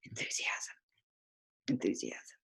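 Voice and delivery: restrained and ashamed. Shown as a demonstration. Showing the restrained way to her audience, a hushed embarrassed "Enthusiasm". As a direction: mutter "Enthusiasm" as if afraid someone hears. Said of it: "a restrained way that may not bring you too much would go".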